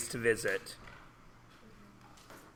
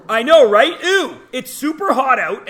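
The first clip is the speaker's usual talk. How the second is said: high, loud disgusted ew; big loud disgust; grossed out; 10 semitones higher, 12 dB louder than usual. Real, not acted. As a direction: recoil at the gross water. Grossed out by warm ocean.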